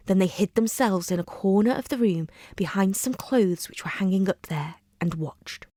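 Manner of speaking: ordinary delivery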